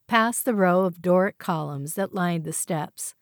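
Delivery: plain reading voice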